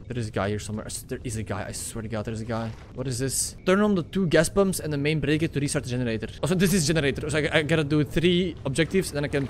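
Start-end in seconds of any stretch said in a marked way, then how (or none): 0.1-3.6 low, hushed and tense. Real, not acted. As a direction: keep it down, something's hunting you. Tense, hunted by a monster.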